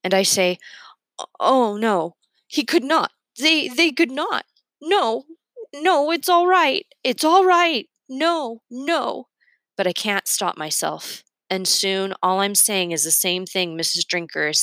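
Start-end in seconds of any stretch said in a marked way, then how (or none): 1.2-9.3 high, insistent refusal to believe; frantic denial. A narrator's reading. Pushing back on unwanted knowledge, frantic denial. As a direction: start stammering, climb high, then ebb on the last no. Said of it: "I push back on it hard".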